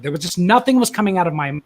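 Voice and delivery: ordinary delivery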